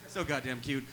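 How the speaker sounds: low, soft, self-satisfied aside; posed corpse voice. Acted. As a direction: murmur it low from the coffin. Corpse answers in the cute pose.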